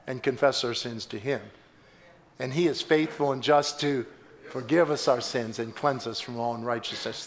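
ordinary delivery

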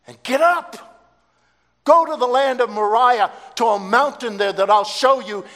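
loud, high commanding call; God calling. Acted. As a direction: call out from heaven with authority. God calls and commands Abraham.

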